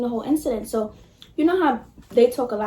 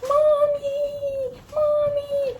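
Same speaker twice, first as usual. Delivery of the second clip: put-on high, whiny child calling Mommy; crying kid voice; 14 semitones higher, much slower than usual. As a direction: wail it like a hurt little kid. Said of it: "he was like".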